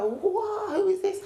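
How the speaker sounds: high, astonished gasp of a question; suddenly struck by the music; amazed. Retold or imitated.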